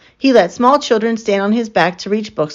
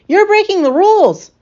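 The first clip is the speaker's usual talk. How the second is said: high, loud outraged scold; fussy librarian scolding the Lion; shocked scolding; 13 semitones higher, 5 dB louder than usual. A narrator's reading.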